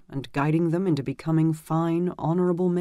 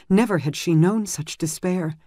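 ordinary delivery, nothing marked

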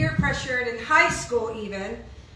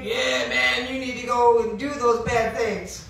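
audible voice in the head; 2 semitones lower than usual. Acted. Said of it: "it even became an audible voice. So loud".

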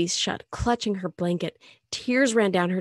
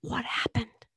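softer hushed whisper; 4 semitones higher, 5 dB quieter than usual. Acted. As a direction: whisper so she stays asleep. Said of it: "Dave whispered".

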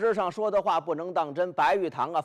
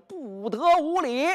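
retold shout; 8 semitones higher than usual. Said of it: "所以喊了一声".

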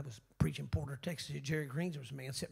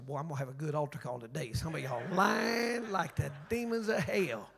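usual speaking voice

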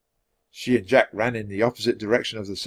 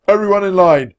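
loud, higher barked order; centurion's shout; 8 semitones higher, 9 dB louder than usual. A narrator's reading. Centurion ordering warriors into line, shouting. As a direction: shout orders to assembled troops. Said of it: "the centurion shouted".

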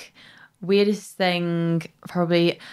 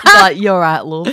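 put-on broad Manchester accent through laughter; northern English accent; 3 semitones higher, 12 dB louder than usual. Imitating a very northern friend, loud Manchester accent. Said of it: "He is so northern".

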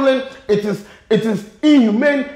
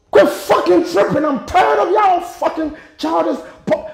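angry shout; 5 semitones higher than usual. Real, not acted. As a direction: shout it out, fed up and furious. Angry rant at politicians.

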